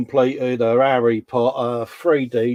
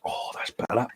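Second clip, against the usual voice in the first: whisper; 10 dB quieter than usual. Real, not acted. Quiet admiring aside.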